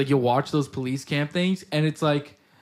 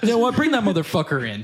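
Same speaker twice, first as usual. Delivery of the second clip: mock suspicious wife; 6 semitones higher than usual. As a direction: play the betrayed wife for laughs. Mock wife turning on him.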